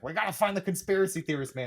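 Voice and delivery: high, quick mock urgency; urgent. Girl pressing her friend to join the search, urgent. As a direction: rattle it out like an eager recruiter.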